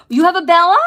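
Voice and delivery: high, loud delighted squeal of a question; thrilled to hear they share the name; squealing surprise; excited surprise. Real, not acted. Excited surprise at a viewer's dog.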